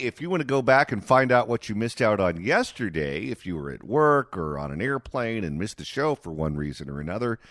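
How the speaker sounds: normal talking voice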